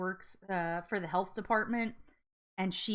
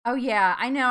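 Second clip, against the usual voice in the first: ordinary delivery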